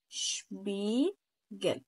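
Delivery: شبيجل drawn out slowly with shp at the start; German word demo; German shp sound. Shown as a demonstration. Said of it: "شبيجل يعني مراية".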